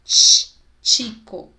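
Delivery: "Tch" burst loudly, then "chico" slowly; CH with T sound. Shown as a demonstration. Teacher showing the CH sound, emphatic demonstration. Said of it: "com som de T".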